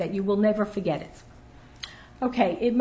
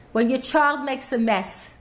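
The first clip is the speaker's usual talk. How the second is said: ordinary delivery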